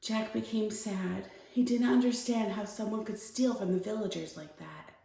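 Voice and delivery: calm, measured bedtime-story read; story narration. A narrator's reading. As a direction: read a fairy tale gently to young children. Reads a story aloud.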